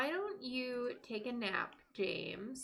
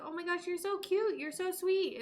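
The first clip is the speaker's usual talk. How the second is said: much higher, cooing over a cute pet; high, doting sing-song praise; gushing; 9 semitones higher, 3 dB louder than usual. Retold or imitated. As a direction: coo it like baby talk. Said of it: "It's always my favorites that I'm like".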